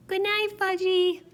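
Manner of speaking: put-on high, loud toddler voice; a tiny kid parroting a goodnight; small boy says goodnight to himself, high and loud. A narrator's reading.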